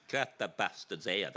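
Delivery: Erica Betts impression. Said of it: "as Erica Betts would say".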